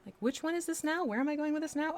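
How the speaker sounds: acted-out muddled thinking aloud; mock confusion. Acts out losing track of characters.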